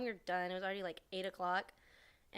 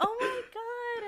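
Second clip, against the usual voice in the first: high, loud, drawn-out squeal; excited greeting squeal; 6 dB louder, slower than usual. Retold or imitated. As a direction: squeal it as if greeting long-lost family. Cousin's delighted greeting, retold.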